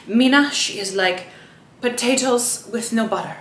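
"potatoes with no butter" in a light attempted Kenyan accent, vowels shifted; admittedly bad imitation of a Kenyan accent on "potatoes with no butter". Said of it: "Sorry, I can't do a Kenyan accent".

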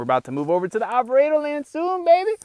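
sharing big news, voice leaping high; thrilled announcement of the move; excited. Real, not acted. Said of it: "super pumped about that".

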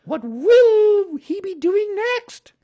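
very high, loud, slow mother kangaroo; high, drawn-out motherly wonder; Kanga's voice. A narrator's reading. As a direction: marvel slowly in a high, loud voice. Kanga humours supposed child.

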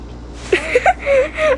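high burst of giggles; giggling. Real, not acted. Child about to kill sister in game, giggling. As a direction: giggle mischievously.

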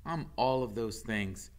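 ordinary delivery

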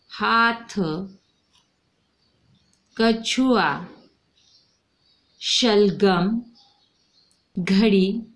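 each word spoken alone, clear and deliberate, with long gaps; model reading for the class. Shown as a demonstration. Teacher showing children how to read picture words, clear and deliberate. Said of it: "देखो".

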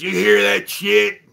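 loud, high mock outrage; imitated angry complaint. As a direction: mimic an irate neighbour confronting someone. Acting out neighbour complaining about music.

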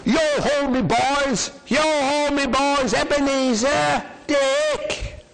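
high, hearty calling-out; a jolly old boss hailing his lads; jovial call. A narrator's reading. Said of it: "He called out in a jovial voice".

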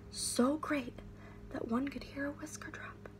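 whispered storytelling; hushed narration. A narrator's reading. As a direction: drop to a breathy hush. Narrator hush.

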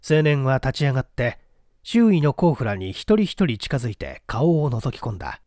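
usual speaking voice

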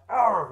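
loud "Arr!" bite growl; mock biting growl. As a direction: growl as you bite down hard. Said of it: "It was like".

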